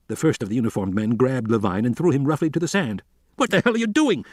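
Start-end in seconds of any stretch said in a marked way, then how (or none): 3.4-4.2 much higher, furious yell. A narrator's reading. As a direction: yell from the sand in outrage. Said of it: "Levine yelled".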